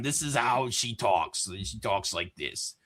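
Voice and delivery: low, soft, mocking growl of the character; lightly put-on gritty killer voice; imitating her voice. Imitating the actress's character for friends, low and soft.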